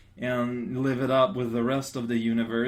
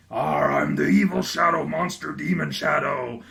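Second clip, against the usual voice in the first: lightly voiced demon monster; hammed-up shadow monster; mock villain voice. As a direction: growl it like a cartoon baddie. Villain line from comic.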